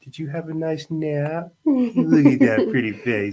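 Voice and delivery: high cooing greeting to an infant. Real, not acted. Dad cooing at the baby.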